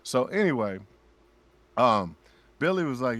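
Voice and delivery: normal talking voice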